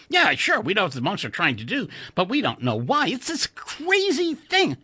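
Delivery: put-on high, stammering young American engineer; eager colleague spilling a secret, pitched high; excited Chuck. A narrator's reading. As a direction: blurt it out, tripping over the first word. Narrator voices Chuck.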